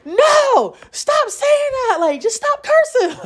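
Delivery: high, drawn-out mom reprimand; exasperated scolding. Retold or imitated. Mom telling daughter to quit cursing, exasperated scolding.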